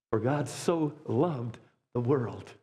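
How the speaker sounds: slow, solemn reading of the verse; lady reading the Bible to the boy, slow and solemn; scripture reading. Retold or imitated. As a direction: read it out slowly like Scripture. Lady reads verse aloud.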